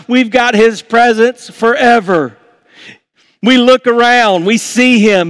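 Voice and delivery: rising sermon climax, calling out to the church; preaching at full force. Acted. Pastor exhorting the congregation to celebrate, at full force.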